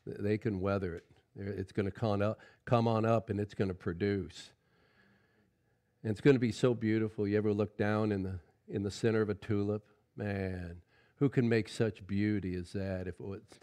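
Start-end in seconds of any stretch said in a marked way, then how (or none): none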